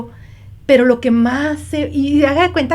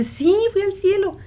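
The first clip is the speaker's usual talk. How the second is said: put-on child's voice; 9 semitones higher than usual. Child insists.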